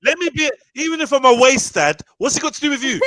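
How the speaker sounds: loud angry rant. Pretend. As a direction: rant at them with fury. Role-play ex ranting.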